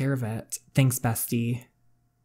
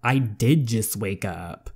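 usual speaking voice

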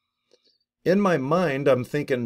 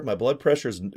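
ordinary delivery

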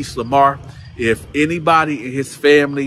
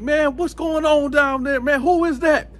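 high-pitched annoyed call down the tier; imitated calling out; 11 semitones higher than usual. Other inmates waking up to the noise, high mock calling. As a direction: mimic woken neighbours demanding answers.

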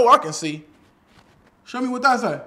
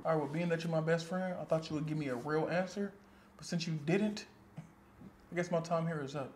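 cold quiet farewell; 4 semitones lower, 13 dB quieter than usual. Acted. Cold goodbye to lying friend.

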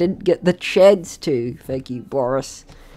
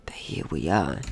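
hushed whisper of arrival; 14 semitones lower, 9 dB quieter than usual. Real, not acted. Player whispering as the new world opens, hushed. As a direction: breathe it out, almost no voice.

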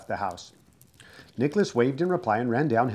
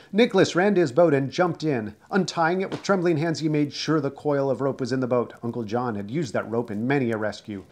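ordinary delivery

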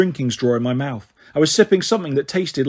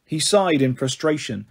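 plain reading voice

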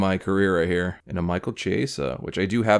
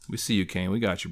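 ordinary talk

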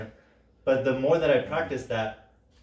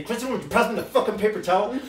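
higher, quicker, exasperated ask; rude request; 10 semitones higher, 4 dB louder, faster than usual. Shown as a demonstration. To Kristen over paper towels, mock exasperated. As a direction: snap the swear in with mock irritation. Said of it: "I turn to Kristen and I say".

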